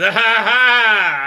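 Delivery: loud, drawn-out fake cackle; hammed-up "found you" laugh; slow theatrical ha-ha-ha; mock triumphant laugh. Voices her triumphant find.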